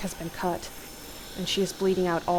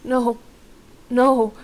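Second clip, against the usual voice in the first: trying to speak; 5 semitones higher, 9 dB louder than usual. A narrator's reading. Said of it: "and try to speak".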